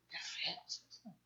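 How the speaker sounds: whispered in disgust. Real, not acted. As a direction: breathe the disgust out. Disgusted aside. (susurro de asco)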